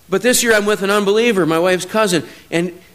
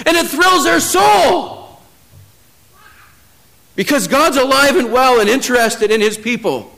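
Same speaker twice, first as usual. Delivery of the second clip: higher, louder, slowed preaching that swells with joy; preaching at full force; 7 semitones higher, 3 dB louder, slower than usual. Acted. Preacher stirring his church about God at work, full-force preaching. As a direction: lift the congregation, voice rising with excitement.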